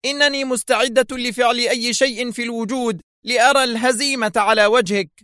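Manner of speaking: high-pitched, impassioned cry; screamed retort. A narrator's reading. Woman vowing to see Adham defeated, screaming. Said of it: "صرخت".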